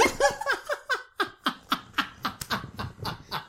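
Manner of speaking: long high helpless laugh; drawn-out giggling fit; laughing hard. Real, not acted. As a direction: crack up, wheezing with laughter. Cracking up at lappy toppy.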